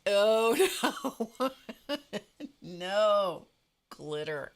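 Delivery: loud, high mock horror, breaking into laughter; horrified reaction. Her reaction to the bag of glitter, loud laughing horror. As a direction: wail it in laughing dismay. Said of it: "And I'm like".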